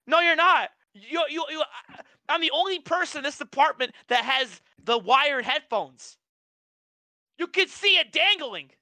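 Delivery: high-pitched exasperated protest; indignant retelling. Retold or imitated. Worker retelling a quarrel about wired headphones, indignant. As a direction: argue back high, drawing out the last line.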